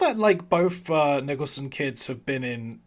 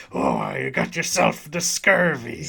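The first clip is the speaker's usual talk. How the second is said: pirate voice; 5 semitones lower, 3 dB louder than usual. Retold or imitated. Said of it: "he just does his pirate voice".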